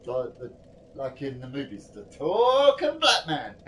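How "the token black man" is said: mocking. Real, not acted. Mocking movie stereotype.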